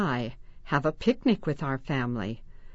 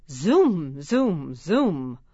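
swooping zoom; 2 semitones lower, 4 dB louder, much slower than usual. Pretend. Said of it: "Hold arms out like bird wings".

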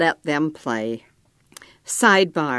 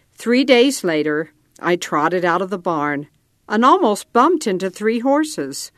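ordinary delivery, nothing marked

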